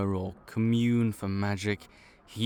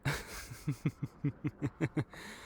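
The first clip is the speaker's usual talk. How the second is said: breathy quiet laugh; 6 semitones higher, 6 dB quieter than usual. Acted. Laughs at odd question.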